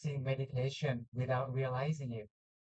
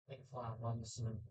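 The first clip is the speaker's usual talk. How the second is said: soft spa whisper; 3 semitones lower, 7 dB quieter, much faster than usual. Acted. Guides breathing in meditation.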